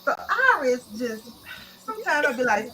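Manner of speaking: ordinary talk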